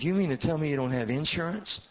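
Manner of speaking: loud, high incredulous question; can't believe what he hears; shocked disbelief. Retold or imitated. Pastor shocked that the new building had no insurance, loud and high.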